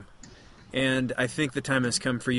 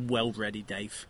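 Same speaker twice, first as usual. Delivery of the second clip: low, softer working-class English reply; lower-class English accent; 4 semitones lower, 8 dB quieter, slower than usual. Acted. Telling Dave he is ready to play, lower-class English accent. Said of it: "kind of a lower class English accent".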